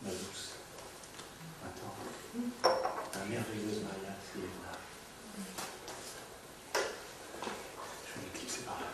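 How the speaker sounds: very soft, low, discreet voice; hushed farewell. Acted. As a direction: take leave almost under your breath. Discreet farewell before slipping out. (adieu murmuré, tout bas)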